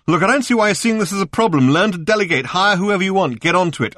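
high, loud exasperated orders; impatient bossing. A narrator's reading. Ford browbeating room service on the phone, impatient. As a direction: lay down the law, impatient.

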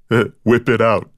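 mild chuckling echo of the phrase; goofy chuckle. A narrator's reading. Deke echoing the phrase with a goofy chuckle. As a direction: chuckle it, a little dumb. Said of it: "Deke said with a goofy chuckle".

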